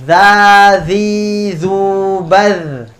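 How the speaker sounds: "Za, zi, zu, baz" with the tongue tip showing; recitation drill. Shown as a demonstration. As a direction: model each syllable for the class. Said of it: "huruf selanjutnya zal". (latihan "za, zi, zu, baz" dengan lidah keluar)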